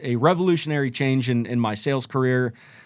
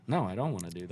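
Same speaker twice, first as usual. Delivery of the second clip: mom declining; 5 semitones lower, 8 dB quieter, much faster than usual. Pretend. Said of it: "what's the mom gonna go".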